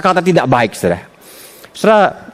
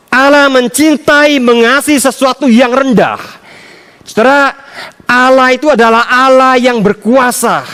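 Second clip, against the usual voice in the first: raised, fervent sermon voice; preaching; 9 semitones higher, 4 dB louder than usual. Acted. Preacher proclaiming God's love to the congregation, fervent.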